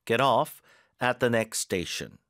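"off" hit hard and slow; slow teacher demo stressing "off". Shown as a demonstration. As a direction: land the weight on "off". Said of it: "뒤에 힘 잘 주시고 get off".

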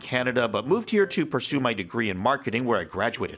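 rushed, run-on self-introduction; nervous, over-excited speed talking; mile a minute. Acted. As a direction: rattle it off without a breath, rising excitedly at the end. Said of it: "you were talking a mile a minute".

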